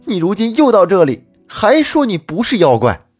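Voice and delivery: husband raging at the demon wife; furious scolding. Acted. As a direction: let the rage boil over at her. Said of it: "骂道说".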